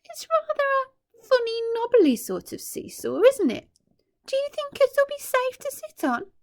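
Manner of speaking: put-on high, wary girl's voice; a cautious girl eyeing the seesaw; doubtful older girl. A narrator's reading. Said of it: "a girl who was older".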